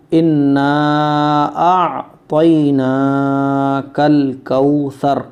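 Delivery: slow, loud teaching recitation; Quran recitation. Shown as a demonstration. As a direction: recite loudly, holding the long vowels.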